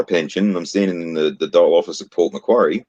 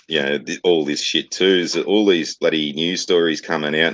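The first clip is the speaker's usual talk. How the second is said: ordinary talk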